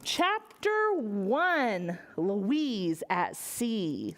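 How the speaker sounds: slow, drawn-out chapter announcement; read-aloud title voice; storybook title. Acted.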